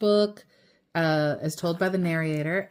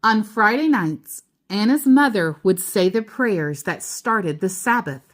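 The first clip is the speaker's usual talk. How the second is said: steady storybook read-aloud; reading to a child; 6 dB louder than usual. A narrator's reading. Parent reading The Keeping Quilt to child, storybook voice. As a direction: read it gently to your child at bedtime.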